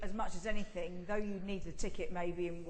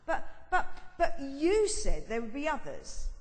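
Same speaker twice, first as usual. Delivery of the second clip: half-laughing, slow protest; a laughing but, but, but; 6 semitones higher, 6 dB louder than usual. Acted. Peter protesting in the script.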